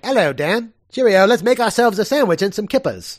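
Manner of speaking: a cheery put-on Cockney greeting; playful Cockney impression; mock Cockney. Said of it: "I was thinking more Cockney".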